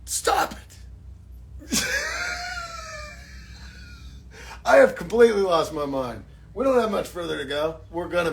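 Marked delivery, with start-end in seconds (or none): 0.1-0.8 whispered stop it as a pretend viewer; mock fan whisper. Pretend viewer whispering at the streamer to stop. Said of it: "And you go". 0.8-4.6 high shrieking laugh. Real, not acted. Laughing at his own bit.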